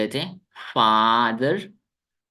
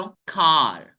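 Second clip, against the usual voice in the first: American English car; 3 dB louder, much slower than usual. Shown as a demonstration. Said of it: "American English में इसको पढ़ते हैं".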